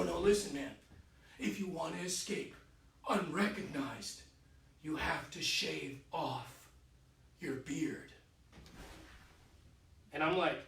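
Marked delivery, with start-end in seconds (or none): 0.0-8.1 low, hushed and slowing, like a friend in a prison cell at night; hushed secret warning. Retold or imitated. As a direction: keep it soft, draw out the last words. Felix warns secretly in prison cell.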